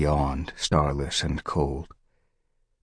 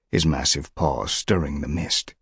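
ordinary delivery

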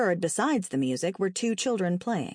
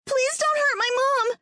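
put-on very high, desperate boy's plea; frantic high-pitched begging; pleading; 4 dB louder than usual. A narrator's reading. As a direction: beg for your mother's life in a child's voice. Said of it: "Terry pleaded".

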